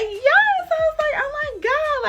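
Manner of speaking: high, loud squeal of joy; triumphant yes. Retold or imitated. Student celebrating her pass, high joyful squeal. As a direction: shriek with relief.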